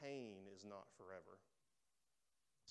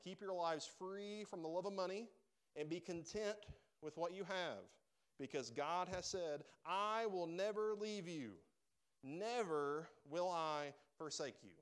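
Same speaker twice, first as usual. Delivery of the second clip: raised, emphatic scripture reading; preaching scripture; 5 semitones higher, 5 dB louder, slower than usual. Acted. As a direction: stretch the key words slowly and forcefully.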